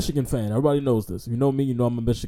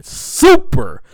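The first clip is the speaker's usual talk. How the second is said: hype shout; 9 dB louder, much slower than usual. Real, not acted. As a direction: call it out like an announcer.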